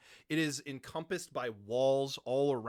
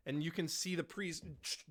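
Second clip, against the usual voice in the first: ordinary talk, nothing marked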